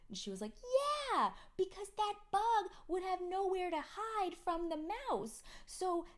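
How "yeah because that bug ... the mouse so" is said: put-on much higher, louder kid voice; imitating a child. Mother imitating daughter, high kid voice. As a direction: toddler lecturing.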